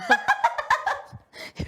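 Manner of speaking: shrieking laugh; loud squealing laugh; high burst of laughter. Real, not acted. Friend digging feet in pillow.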